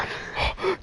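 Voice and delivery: sharp mock gasp of alarm; panicked gasp. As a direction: gasp like a mom in a retold panic. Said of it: "my mom was panicking".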